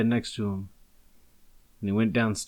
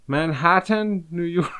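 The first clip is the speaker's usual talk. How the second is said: mock Mexican accent; 7 semitones higher, slower than usual. Shown as a demonstration. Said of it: "put on your best Mexican accent".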